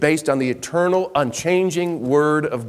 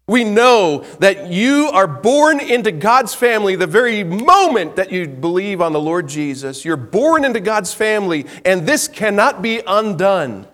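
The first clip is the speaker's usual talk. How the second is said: preaching at full force; 6 semitones higher, 5 dB louder than usual. Acted.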